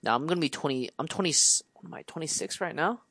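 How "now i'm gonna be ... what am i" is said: ordinary talk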